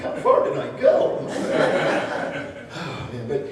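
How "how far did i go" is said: mock surprise. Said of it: "I'm like".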